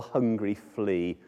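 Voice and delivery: usual speaking voice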